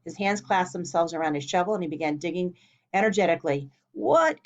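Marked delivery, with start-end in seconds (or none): 3.9-4.5 high startled yelp; startled cry. A narrator's reading. Boy startled by his own digging, startled cry. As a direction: blurt it out in shock. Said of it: "cried Georgie".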